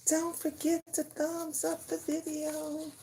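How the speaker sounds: high bright reminder. Acted. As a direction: lift it into a high sing-song voice. Same line retaken much higher.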